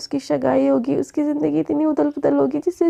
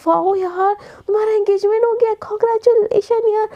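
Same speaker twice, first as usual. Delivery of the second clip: higher, gushing congratulations; excited friends; 6 semitones higher than usual. Acted. Friends gush over the engagement. (उत्साहित सहेलियों की ऊँची आवाज़ में बधाई)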